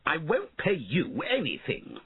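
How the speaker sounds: high, indignant refusal; shouted back. A narrator's reading. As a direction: refuse in a high-pitched huff. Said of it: "shouted back Sir Felton".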